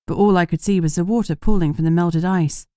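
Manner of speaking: plain reading voice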